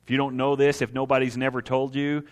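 ordinary talk

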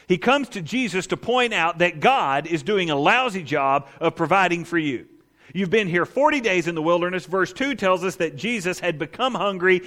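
ordinary talk, nothing marked

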